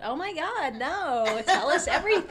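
enthusiastic blurt. Retold or imitated. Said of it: "She blurts out".

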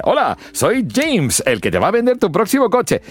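high, salesy car-dealer pitch; vendedor de coches. Acted. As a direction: sell it like a used-car dealer on an ad. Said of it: "los típicos vendedores de coches". (vendedor de coches americano, entusiasta y agudo)

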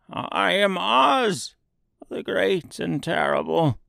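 put-on a touch of a quaking old man; trembling voice. A narrator's reading. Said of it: "in a trembling voice".

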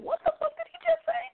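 very high-pitched mock disbelief; incredulous. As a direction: imitate someone laughing in stunned disbelief. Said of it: "incredulously, just like everybody else, going".

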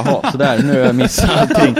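loud, exasperated "well that's that"; frustrated outburst. Retold or imitated. To co-hosts, recalling missing every zone, loud and exasperated. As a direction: vent it loudly, fed up.

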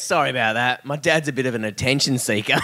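low, understated apology; low-key embarrassed son; deadpan apology. Acted.

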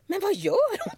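high puzzled wondering. Retold or imitated. Colleague watching Pamela, high puzzled wondering. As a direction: sound baffled by her.

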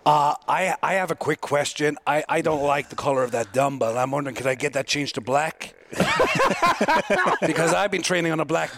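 lightly put-on voice of a fussy strongman; guessing-game impression of a fussy strongman; impression of an athlete. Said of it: "Who do you think I am now?".

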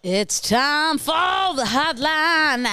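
slow, drawn-out high announcer call; exaggerated hotline intro; segment announcement. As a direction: stretch it out like a show jingle.

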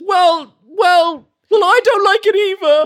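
high, loud sulky stammer; sulky guard. Acted. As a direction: admit it like a caught kid. Guard voiced sulkily.

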